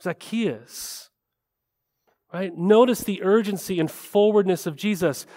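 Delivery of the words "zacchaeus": tender call. Retold or imitated. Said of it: "He says".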